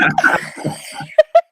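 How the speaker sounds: loud, high burst of laughter; laughing. Real, not acted. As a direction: crack up at your own story. Laughs at dad's quick hang-up.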